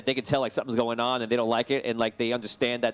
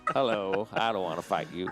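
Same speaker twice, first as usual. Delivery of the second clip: reluctant knight; 4 semitones lower, 4 dB quieter than usual. Acted.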